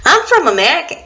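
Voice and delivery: light put-on American accent, rising; playful fake American for friends to guess; mock American accent. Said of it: "accents challenge".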